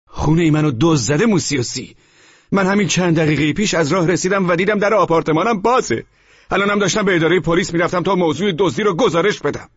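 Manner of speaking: high, urgent tenant cutting in; tenant's voice. Acted. Tenant telling the landlord he was robbed, urgent. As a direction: break in with the news of the theft.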